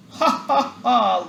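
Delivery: big scornful lion laugh; mocking laugh. A narrator's reading. The lion laughs at the little mouse's promise, mocking. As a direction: laugh him off, the tiny mouse helping you. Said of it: "laughed the lion".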